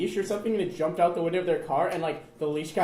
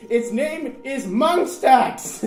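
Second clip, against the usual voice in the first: very slow, higher and louder, stretched out; toddler naming the pet; 7 semitones higher, 7 dB louder, much slower than usual. Retold or imitated. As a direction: announce the baby's name proudly and slowly. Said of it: "the first thing I said was".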